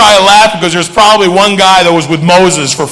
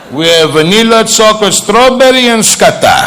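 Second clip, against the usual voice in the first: put-on Greek-accented list climbing in pitch, then dropping; father's Greek accent; 4 semitones higher than usual. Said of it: "have an accent?".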